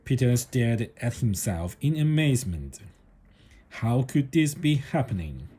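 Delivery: usual speaking voice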